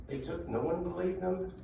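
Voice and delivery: ordinary talk, nothing marked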